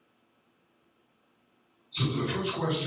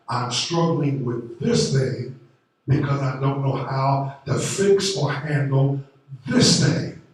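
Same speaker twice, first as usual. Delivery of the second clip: higher, louder sermon build, hammering each phrase; preaching at full force; 3 semitones higher, 8 dB louder than usual. Acted. Pastor pressing the congregation about faith, preaching at full force. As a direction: drive it home to the congregation.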